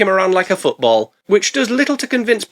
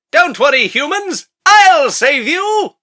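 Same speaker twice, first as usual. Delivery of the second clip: high, hammed-up rescuer's call; high voice, last words long and loud; heroic crocodile; 12 semitones higher, 4 dB louder, much slower than usual. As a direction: swoop in as a cartoon croc hero. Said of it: "the giant crocodile then bursts out of the water like".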